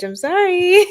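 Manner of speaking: high, loud, laughing whine; laughing apology. Real, not acted. Streamer sorry for butchering French, laughing apology. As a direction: break into laughter as you apologise.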